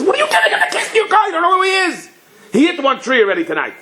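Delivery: high-pitched protesting wife with a laugh; worried wife. Retold or imitated. Wife protesting her husband lending the car, high and laughing. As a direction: squeak it out like the anxious wife, half laughing.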